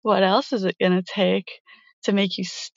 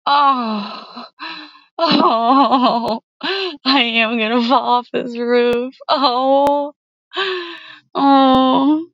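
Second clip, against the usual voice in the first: building moans; 5 semitones higher, much slower than usual. Acted. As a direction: let the pleasure build to a cry. Rising pleasure, near falling.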